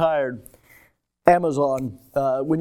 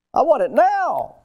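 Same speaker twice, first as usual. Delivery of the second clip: higher, louder mock customer demand; impatient demand; 10 semitones higher, 5 dB louder than usual. Preacher playing a customer waiting on Amazon, impatient demand.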